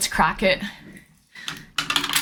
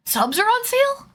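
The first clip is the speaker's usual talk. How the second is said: surprised; 9 semitones higher than usual. Real, not acted. Surprised question.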